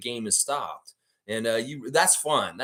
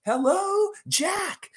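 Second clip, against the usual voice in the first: much higher falsetto voice, comic; silly falsetto greeting; much slower than usual. Retold or imitated. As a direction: squeak it like a cartoon. Mimicking greeting to Jack.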